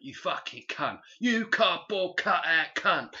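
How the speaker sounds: a loud, slow, sweary hard-man rant; mock Danny Dyer. Host mocks the film's sweary gangster dialogue, hammed-up tough guy. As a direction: ham up a Cockney gangster tirade.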